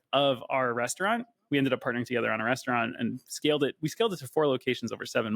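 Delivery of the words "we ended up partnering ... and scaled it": usual speaking voice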